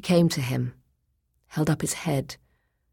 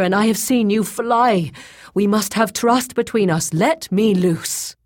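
raised, loud, insistent pleading; urgent persuasion; 6 semitones higher, 5 dB louder than usual. A narrator's reading. Bound Boyce persuading Grey Alis to untie him, urgent pleading. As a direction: press her earnestly to trust you.

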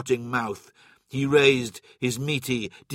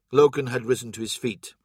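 ordinary delivery, nothing marked

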